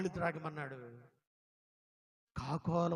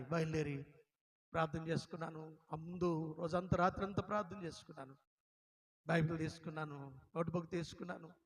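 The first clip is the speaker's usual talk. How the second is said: ordinary talk, nothing marked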